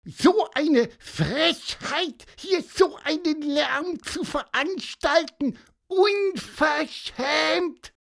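very high, slow, indignant squawk; bird scolding. Acted. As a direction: scold them like an outraged little bird. Pieper scolds the rowdy men.

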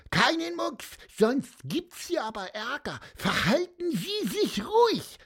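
high piping little bird; squeaky bird voice. A narrator's reading. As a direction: chirp it out like a tiny bird. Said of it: "piepste Pieper".

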